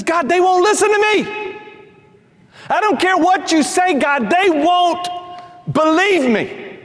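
whining Moses. Acted. Preacher plays Moses refusing God, high and pleading. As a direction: stretch out they won't, begging.

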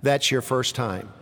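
low, soft and calm; wife calmly answering her shocked husband; calm reply. A narrator's reading. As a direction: answer him coolly and calmly. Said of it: "calmly said".

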